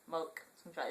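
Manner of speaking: "Remote controller" begun on "mote", like "moke"; re- dropped. Shown as a demonstration. Vlogger showing the home word, re- dropped. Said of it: "M O K E".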